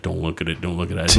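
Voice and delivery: quiet nurse warning him gently; hushed warning. Retold or imitated. A nurse taking off his cast, a soft hushed warning. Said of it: "she's like".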